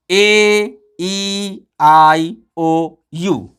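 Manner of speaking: ए, ई, आई, ओ, यू each stretched and separate; long vowel sounds. Shown as a demonstration. Teacher models long sounds for pupils, long vowel sounds. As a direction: hold every vowel like its letter name. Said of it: "यही इसका स्वर है".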